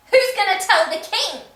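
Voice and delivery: escalated outrage. Real, not acted. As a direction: blow up the repeat into a shout. Repeated, escalated.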